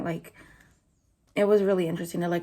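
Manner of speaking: normal talking voice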